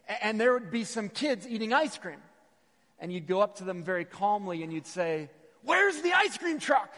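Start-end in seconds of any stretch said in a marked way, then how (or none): 5.6-6.9 put-on high, loud, drawn-out kid's demand; comically frantic yell, pitched way up; frantic mock shout. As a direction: blurt it out like a desperate ice-cream-hunting kid. Frantic kid, jokingly not calm.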